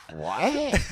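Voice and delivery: high mock-baffled question; uncomprehending girl. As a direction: imitate a girl who just doesn't get it. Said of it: "the girl, like, just didn't understand".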